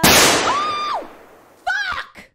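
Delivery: very high, loud shriek; jump-scare shriek breaking into a curse; startled scream. Real, not acted. Gamer jolted by scare alert, high startled scream.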